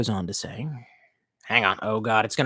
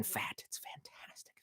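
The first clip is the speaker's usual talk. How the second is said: whisper; 27 dB quieter, slower than usual. Pretend. Imagined flirting quote.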